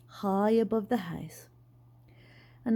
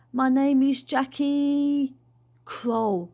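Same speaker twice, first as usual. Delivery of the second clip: trying to remember; 3 semitones higher, 3 dB louder, much slower than usual. A narrator's reading. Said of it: "He tried to remember".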